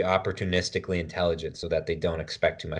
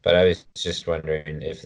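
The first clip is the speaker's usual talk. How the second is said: ordinary delivery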